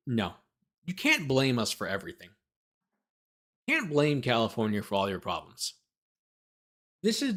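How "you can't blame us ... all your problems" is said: ordinary talk, nothing marked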